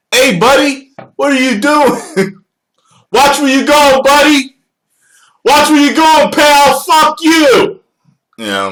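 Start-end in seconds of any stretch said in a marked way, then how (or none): 0.1-2.0 much higher, louder mock confrontation; mock angry local. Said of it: "People will call you buddy". 3.1-7.8 much higher and louder, yelling at a driver; hammed-up angry yell at a careless driver; mock road rage. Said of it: "People will call you buddy".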